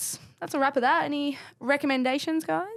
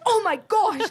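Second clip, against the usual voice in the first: high, louder mock startled cry; startled outburst; 9 semitones higher, 5 dB louder, slower than usual. Ruby jumps in her seat when teammates interrupt her reading, startled. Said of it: "jumped out of her seat".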